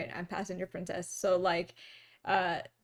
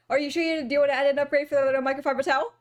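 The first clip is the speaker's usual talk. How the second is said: rapid-fire upsell; words run together at speed; fast talker; much faster than usual. Retold or imitated. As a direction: imitate an attendant racing through the offer. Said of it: "talking so fast".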